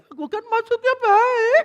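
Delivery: loud, very high mock wail; child's inner scream. Misunderstood child screaming inwardly at papa, high mock scream. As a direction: scream it like a wronged, protesting child. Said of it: "anaknya menjerit dalam hati".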